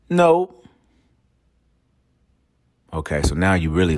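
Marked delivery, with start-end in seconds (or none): 0.1-0.6 blunt high "No." from the husband; flat no. Retold or imitated. Husband answers the wife with a blunt high no. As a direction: shut her down with one sharp high word.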